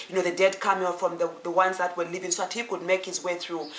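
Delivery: ordinary delivery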